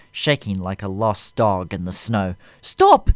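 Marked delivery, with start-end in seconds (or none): 2.6-3.1 very high, loud desperate cry. A narrator's reading. Aide crying out to Vader, high panicked scream. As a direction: scream it in terror. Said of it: "he cried".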